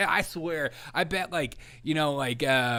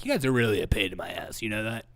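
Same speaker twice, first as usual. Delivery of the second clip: Joe Biden impression; 4 semitones lower, 3 dB quieter than usual. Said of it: "he's like".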